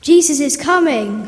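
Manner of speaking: loud, excited call to the crowd; somebody in the crowd shouts that Jesus is coming; shouted announcement. A narrator's reading. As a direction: call out the news to everyone. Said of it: "somebody shouted".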